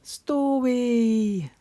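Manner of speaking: a tiny piglet squeaking out the word; George. Acted. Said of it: "giggles George".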